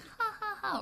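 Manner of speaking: high ha ha ha, a light put-on laugh; mock laugh. Shown as a demonstration. Teacher shows children what normal laughing sounds like, mock high laugh. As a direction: toss off a quick, high, pretend giggle. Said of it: "you're laughing like".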